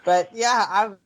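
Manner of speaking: ordinary talk, nothing marked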